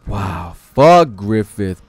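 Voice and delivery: ordinary talk, nothing marked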